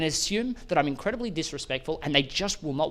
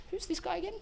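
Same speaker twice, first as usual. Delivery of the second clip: puzzled quip; 13 semitones higher, 8 dB quieter than usual. Retold or imitated. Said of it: "we're like".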